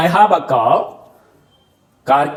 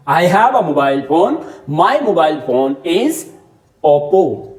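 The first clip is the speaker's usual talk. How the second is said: slow example; much slower than usual. Shown as a demonstration. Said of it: "कितनी तरह से कार को बोल सकता हूं".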